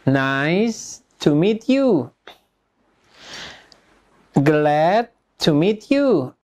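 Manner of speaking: slow, stretched-out phrases for learners; slow language-drill delivery; repeat-after-me model. Shown as a demonstration. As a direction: model each greeting slowly, with pauses. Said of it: "Repeat after me".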